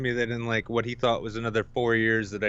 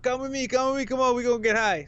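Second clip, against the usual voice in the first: higher mock Caribbean urging; Caribbean accent; 12 semitones higher, faster than usual. Dealer urging him to come get high, Caribbean accent. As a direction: beckon him in a Caribbean lilt. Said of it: "thick Caribbean accent".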